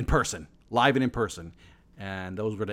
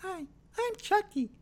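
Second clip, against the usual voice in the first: much higher squeaky killer doll voice; a goofy squeaky impression of a horror doll; terrible Chucky impression. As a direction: do a bad creepy doll. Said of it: "terrible impression of Chucky".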